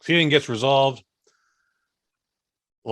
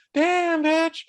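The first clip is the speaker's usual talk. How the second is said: impressed exclamation. Retold or imitated. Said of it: "that last one was me".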